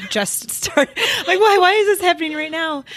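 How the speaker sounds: real laugh caught between crying and surprise; teary laughter. Real, not acted. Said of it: "now I'm, like, starting to tear up".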